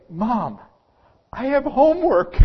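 put-on high-pitched little kid bursting with news; excited kindergartner. Kindergartner tells mom about the first homework, excited. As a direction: squeal it proudly, way up high. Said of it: "They are so excited about homework".

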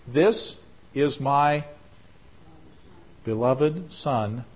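very slow, solemn voice of heaven; voice of God. Acted. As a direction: speak each word slowly, like God from above. God's voice quoted, slow.

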